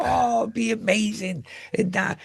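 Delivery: dreamy high voice. Pretend. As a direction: swoon over the dream in a lifted voice. Daydreaming about fairy tale holidays.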